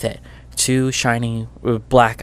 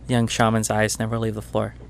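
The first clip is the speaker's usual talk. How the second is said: ordinary delivery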